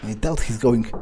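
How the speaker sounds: ordinary talk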